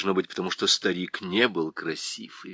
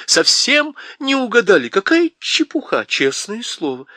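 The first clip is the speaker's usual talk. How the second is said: put-on high, loud girl's outcry; shouting; 13 semitones higher, 8 dB louder than usual. A narrator's reading. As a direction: shout it at the queen in protest. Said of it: "а Полли крикнула".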